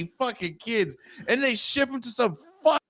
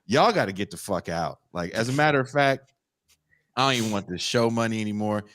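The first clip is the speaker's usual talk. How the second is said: much lower, stern mock scolding voice; the brown people throw the rude kids out, much lower stern scold; scolding host parent; 13 semitones lower than usual. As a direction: drop way down and tell the brats off. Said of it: "the brown people are like".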